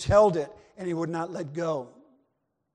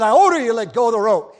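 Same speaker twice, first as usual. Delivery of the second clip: loud command; 3 semitones higher, 12 dB louder, faster than usual. Acted. Captain ordering.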